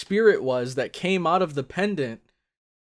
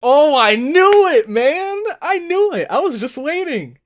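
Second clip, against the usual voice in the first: high-pitched, vindicated cry; excited outburst; 9 semitones higher, 6 dB louder than usual. Real, not acted. Viewer sees the expected dudes finally show up, excited outburst. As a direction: burst out loud on the first words, staying high.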